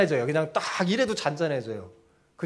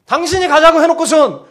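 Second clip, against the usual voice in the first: loud, high-pitched accusing outburst; angry yelling; 10 semitones higher, 11 dB louder than usual. Acted. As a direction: yell at the one who got you into this. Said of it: "고래고래 소리를 지르고 있는 상황". (화가 나서 고래고래 소리 지르듯)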